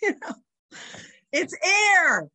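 high, loud mock whine; mock protest. Girls complaining to their spelling teacher, mock protest. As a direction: wail the correction, stretching "air".

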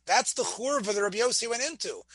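ordinary talk, nothing marked

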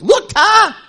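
high, loud, laughing call; excited little girls. Retold or imitated. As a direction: call Dad like a thrilled child. Daughters calling their father.

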